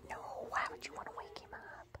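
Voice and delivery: hushed whisper; whispering over a crib. Real, not acted. Hushing so baby stays asleep.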